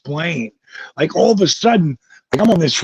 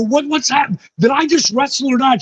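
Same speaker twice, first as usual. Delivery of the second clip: higher, quicker "Did I just wrestle or not?"; agitated question; 7 semitones higher, faster than usual. Retold or imitated. Concussed wrestler presses his wife for answers, higher and rattled. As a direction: push her for the answer, rattled.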